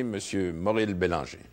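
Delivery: ordinary talk, nothing marked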